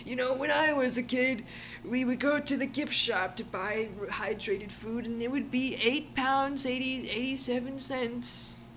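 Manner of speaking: put-on grumpy old timer voice; back in my day rant. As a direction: play a cranky senior ranting. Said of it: "I'm in my sixties".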